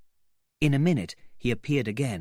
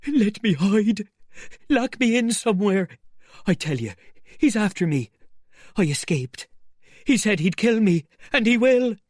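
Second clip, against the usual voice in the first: terrified pleading; 11 semitones higher, 7 dB louder than usual. Acted. Marvel pleading with the men, voice high with fear. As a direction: beg desperately for shelter.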